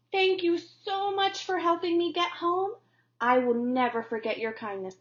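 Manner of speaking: a tiny bee overflowing with thanks; grateful little bee. Acted.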